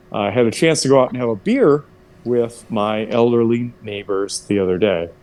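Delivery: normal talking voice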